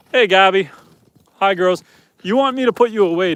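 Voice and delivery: ordinary talk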